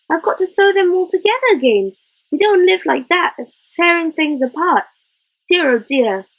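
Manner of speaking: lightly voiced, worried woman tutting over the mess; dismayed housewife. Joan dismayed at torn sheets.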